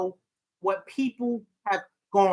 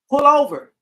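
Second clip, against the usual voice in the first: louder, higher, firm order; retold urgent call to pull over; urgent command; 7 semitones higher, 9 dB louder than usual. Counselor urging a distressed caller to stop driving, urgent command.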